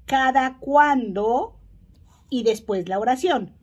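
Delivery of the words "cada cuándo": pronunciado despacio para alumnos; lento y claro. Shown as a demonstration. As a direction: marca cada sílaba de "¿cada cuándo?" despacio. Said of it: "Hoy estudiaremos la pregunta". ("¿cada cuándo?" dicho lento y claro)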